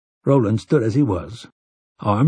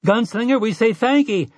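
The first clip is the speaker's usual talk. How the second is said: much higher calling voice; woman calling out; 11 semitones higher than usual. A narrator's reading. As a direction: call across the crowd to the gunslinger. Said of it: "a woman called".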